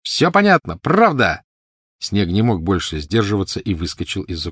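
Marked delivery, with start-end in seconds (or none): 0.0-1.4 put-on high, loud eager child; excited outburst. A narrator's reading. Snape bursting from the bushes, excited. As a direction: blurt it out, unable to hold back.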